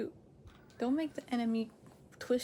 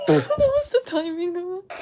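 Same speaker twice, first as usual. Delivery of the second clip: high, loud laughing cry; laughing through the words; laughing hard; 13 semitones higher, 11 dB louder than usual. Real, not acted. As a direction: burst out laughing at the timing. Laughing at spoiler timing.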